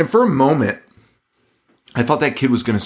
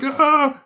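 sudden high yelp, half laughing; mock pained yelp. Imitating the priest touching the satanic altar, a high yelp. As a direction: yelp like a hand just touched a hot stove. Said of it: "the reaction of, like".

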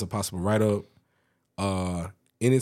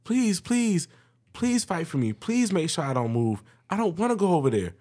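high, begging plea; mock pleading coworker; 9 semitones higher than usual. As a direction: beg pitifully, voice raised high. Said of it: "she was like".

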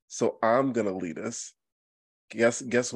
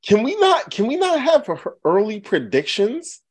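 indignant; 11 semitones higher, 10 dB louder than usual. Real, not acted. Indignant defence against teasing.

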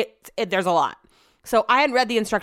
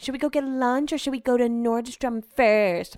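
mock snooty women; 4 semitones higher than usual. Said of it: "I heard them talking".

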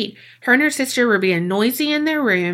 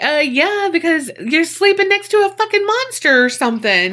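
higher-pitched mock exasperation; incredulous retort; 8 semitones higher than usual. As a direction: answer as if it's painfully obvious. Acts out retort to drawing question.

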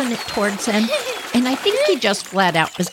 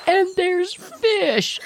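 high, slow whine; whiny child; 11 semitones higher, much slower than usual. Retold or imitated. Little girl telling her brother about the fish in a high slow whine. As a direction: stretch it out like a scared kid.

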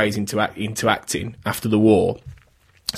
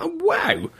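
mock surprised gasp. Surprise on learning he was Canadian.

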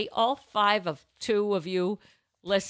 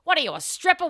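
ordinary talk

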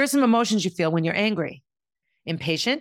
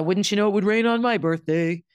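gloomy Eeyore; 2 semitones higher, 3 dB louder than usual. Retold or imitated. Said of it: "my Eeyore connection".

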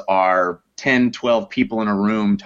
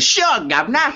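put-on jokey Cockney-style British accent, pitched well up; mock British accent. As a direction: toss it off in a cartoon British accent, teasing. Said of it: "Can you do an English accent?".

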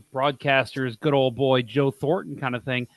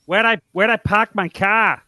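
mock New England accent; 4 semitones higher than usual. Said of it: "work on our New England accents".

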